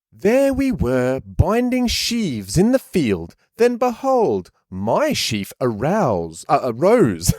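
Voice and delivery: put-on high, slow posh kid telling his dream; a smug little rich boy, drawn out. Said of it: "posh kid's voice".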